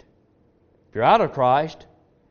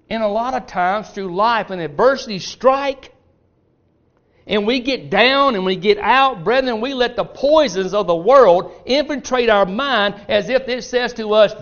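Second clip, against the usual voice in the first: higher and louder, preaching at full force; fiery preaching; 7 semitones higher, 6 dB louder than usual. Acted. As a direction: build the sermon up into a loud exhortation.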